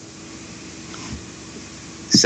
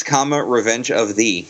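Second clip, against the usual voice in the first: ordinary delivery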